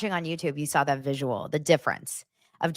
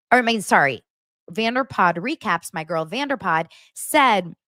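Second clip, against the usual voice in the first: ordinary talk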